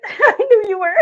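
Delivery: high laughing squeal to the cat. Real, not acted. Owner laughing at her cat, high and laughing. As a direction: laugh through the words.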